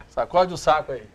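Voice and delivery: chuckling through the words; laughing. Real, not acted. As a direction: laugh while you say it. Joking while shaking the bag.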